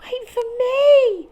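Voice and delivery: high, small squeaky call; tiny Dormouse cry. Acted. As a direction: call out like a little mouse left behind. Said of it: "cried Dormouse".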